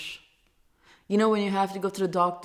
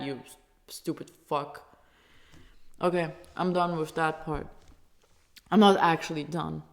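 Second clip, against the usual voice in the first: usual speaking voice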